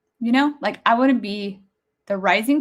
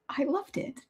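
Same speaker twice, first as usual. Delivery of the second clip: tearful, breathy whisper much softer; crying whisper; 10 semitones higher, 9 dB quieter than usual. Real, not acted. Overcome remembering the girls, whispering through tears. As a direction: barely get it out through tears. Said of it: "how emotional this is making me".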